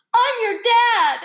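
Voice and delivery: loud, high, drawn-out exuberant shout; excited greeting. Retold or imitated. Co-star greeting the new cast member, excited shout. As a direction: call it out big and joyful. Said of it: "gave me a huge hug, and goes".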